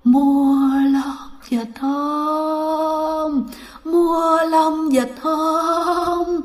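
ghostly street-vendor cry, very drawn out; long-held, droning graveyard moan; eerie moaning call. Acted. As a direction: wail it slowly, stretching every word. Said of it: "Mà rên thấy ghê lắm các bạn".

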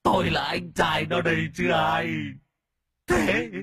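high, slow, drawn-out tearful wail; whimpering ghost wailing. A narrator's reading. A ghost laments his misery to the master, tearful wailing. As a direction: moan out every word as a blubbering spirit. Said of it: "mếu máo ôm mặt khóc tu tu".

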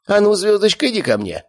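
high lady's coaxing call, dropping at the end; lady calling her lapdog over; calling the dog. A narrator's reading. Lady calls her little dog.

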